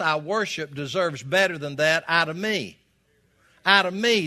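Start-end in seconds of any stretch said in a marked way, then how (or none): 3.7-4.3 Out of me, higher and louder; emphatic repeat. Acted. Pastor presses the point on his congregation, louder and higher. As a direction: drive Out of me home to the room. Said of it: "Did you get that part?".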